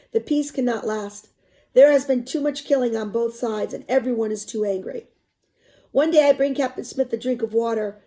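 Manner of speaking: expressive read-aloud narration; story narration. A narrator's reading. Teacher reading a novel to students, expressive narration.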